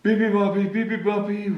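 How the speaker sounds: ordinary delivery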